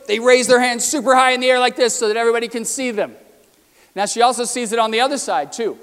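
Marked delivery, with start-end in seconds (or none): 0.1-3.2 fast, louder and higher, like an eager student showing off. Shown as a demonstration. Lecturer mimicking alpha students at Harvard, eager and showy. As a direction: act out the keen alphas grabbing attention.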